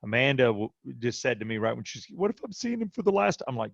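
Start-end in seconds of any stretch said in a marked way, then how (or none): 2.1-3.5 much higher voice, imitating a frightened mother; fearful wife. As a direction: voice a wife's dread in a raised pitch. Wife fearing for her son's life.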